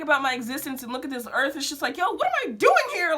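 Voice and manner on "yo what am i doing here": high, quick, mildly panicked outburst; retold panic. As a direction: act out a moment of existential panic. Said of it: "just really panicking".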